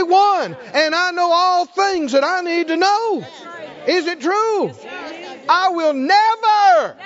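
high, ringing proclamation; full-force preaching. Real, not acted. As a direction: declare it fervently to rouse the congregation.

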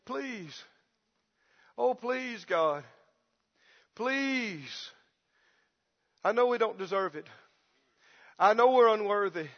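quiet, pitiful mock prayer; imitated timid pleading to God; begging prayer. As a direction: plead meekly like an unworthy beggar. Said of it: "The only way they know how to pray is".

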